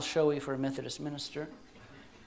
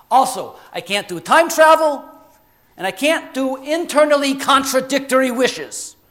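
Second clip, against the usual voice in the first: lightly voiced genie laying down the rules; bossy genie; 4 semitones higher, 10 dB louder than usual. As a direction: lay down the rules like a brash genie.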